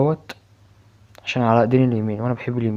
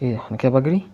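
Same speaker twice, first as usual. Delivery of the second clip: ordinary talk, nothing marked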